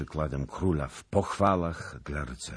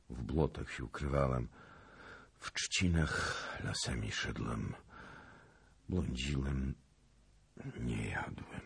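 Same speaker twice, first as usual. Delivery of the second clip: weakly; 2 semitones lower, 5 dB quieter than usual. A narrator's reading. Starved knight telling how he got through, weakly. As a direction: let each phrase sink with fatigue. Exhausted knight tells his ordeal.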